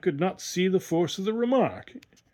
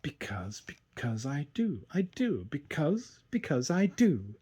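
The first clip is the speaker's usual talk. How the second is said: soft, drawn-out rhythmic repetition; rhythmic sing-song; 6 dB quieter, slower than usual. A narrator's reading. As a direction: lilt it softly like a dancing rhyme. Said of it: "to the rhythm of his words".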